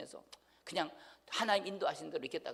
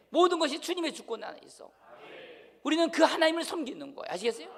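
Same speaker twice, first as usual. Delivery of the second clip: loud, high-pitched pulpit proclamation; forceful preaching; 6 semitones higher, 7 dB louder than usual. Real, not acted. Pastor telling the congregation everything comes from God, forceful preaching. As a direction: drive each declaration home with raised voice.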